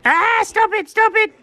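very high panicked plea; freaked out. Acted. Cat begs Chuck to stop mimicking it, freaked out. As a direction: squeal for it to stop. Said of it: "you're freaking me out".

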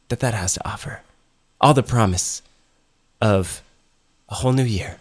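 soft, halting words with long pauses; hushed build-up. Acted. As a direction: let it fade toward a whisper. Mock-solemn build to a song.

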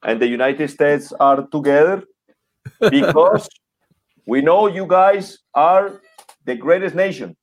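mock clumsy Colombian English; Colombian-accented English. Mocking the ex-president answering Obama, thick Colombian English. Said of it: "así no se habla un idioma".

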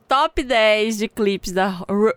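normal talking voice

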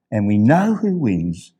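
ordinary talk, nothing marked

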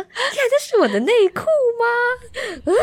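high, slow mock shock at her own photo; a replayed moment of horror; shocked gasp. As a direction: gasp it out, slowly, in disbelief. Shocked at seeing her underwear.

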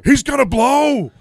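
very high, loud slow yell of warning; alarmed shout. Retold or imitated. Partner warning everyone he will vomit, shrill shout. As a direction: scream the warning across the bus.

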